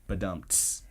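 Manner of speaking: low, soft vocal drum sting; mouthed rimshot. Shown as a demonstration. Host capping the row deal pun, mouthed rimshot. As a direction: imitate a comedy rimshot with your mouth.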